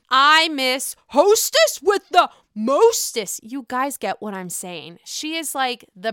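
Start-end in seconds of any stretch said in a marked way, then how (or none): 0.1-3.4 slow, loud, hammed-up "hostess with the mostest"; Dorinda catchphrase. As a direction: ham it up slowly like a reality star.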